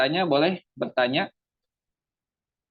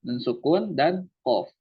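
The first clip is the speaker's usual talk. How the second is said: ordinary delivery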